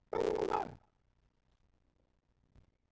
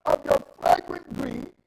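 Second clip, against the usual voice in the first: preaching at full force; 10 semitones higher, 11 dB louder than usual. Acted. Preacher voices God's anger at greed, loud full-force preaching. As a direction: thunder God's wrath from the pulpit.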